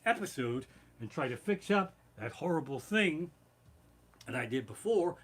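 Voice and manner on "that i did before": ordinary talk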